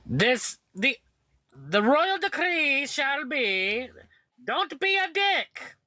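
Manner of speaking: high, slow royal proclamation; regal voice. Acted. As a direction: proclaim it like a pompous monarch. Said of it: "command in my regal voice".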